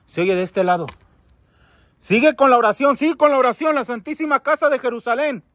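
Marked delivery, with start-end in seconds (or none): 2.0-5.4 high, raised voice urging someone on; urgent calling out. Real, not acted. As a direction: call out insistently. Urging the spirit to keep praying. (exhortación urgente en voz alta)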